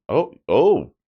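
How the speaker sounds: sudden high, loud oh; surprised oh. Real, not acted. Player surprised by the stamina boost, loud high oh. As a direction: caught off guard by the upgrade.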